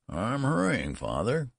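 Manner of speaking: high, drawn-out son's voice; harried old son. A narrator's reading. Old son dressing as father hurries him, high harried reply. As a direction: answer father in a high, placating voice.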